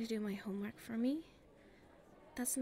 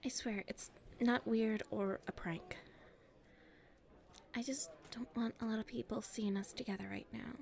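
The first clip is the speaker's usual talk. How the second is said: hushed and secretive, keeping it private; nervous confiding whisper; shy whisper; 2 semitones lower than usual. Acted. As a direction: whisper so nobody around can hear.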